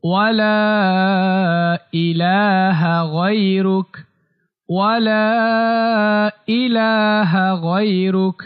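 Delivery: ওয়া লা held long, then ইলাহা গায়রুক recited slowly; measured recitation with a long hold on ওয়া লা; three-alif elongation. Shown as a demonstration. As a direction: stretch the "laa" of ওয়া লা on a steady tone. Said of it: "তিন আলিফ টান দিতে হবে".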